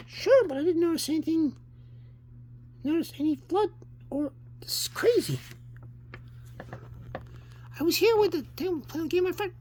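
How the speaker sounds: put-on very high child voice; young son. Boy explaining himself to his dad, high and puzzled. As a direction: sound like a puzzled small boy.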